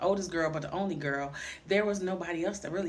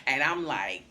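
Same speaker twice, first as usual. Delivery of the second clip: usual speaking voice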